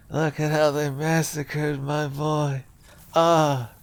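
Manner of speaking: a lightly voiced, hushed lament for a fallen son; mock grieving father. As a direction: play a grieving mob boss over his boy. Mourning Patches, movie-quote style.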